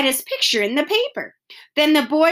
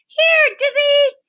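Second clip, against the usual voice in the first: much higher, louder call of the dog's name; shouted; 7 dB louder, slower than usual. Acted. The boy shouting to his lost dog, high and loud. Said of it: "the boy shouted".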